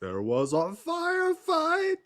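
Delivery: high, slow, drawn-out cry; hammed-up dramatic movie shout; film-line impression. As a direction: stretch the movie quote into a rising howl. Quotes a beloved film's famous line.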